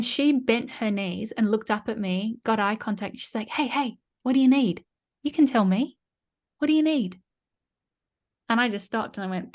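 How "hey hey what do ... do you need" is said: calming guard. Pretend. Prison guard calms role-played prisoner.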